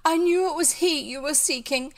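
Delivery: high theatrical heroine; crying out. A narrator's reading. Bet reveals her knowledge before fainting, high melodramatic cry. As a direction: declaim it breathlessly dramatic before a faint. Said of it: "cried Bet".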